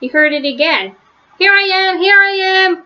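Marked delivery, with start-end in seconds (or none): 1.4-2.8 higher, louder repeated call; shouting. A narrator's reading. Lost Franklin answering his searchers, shouting. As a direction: shout to be found. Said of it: "Franklin shouted over and over".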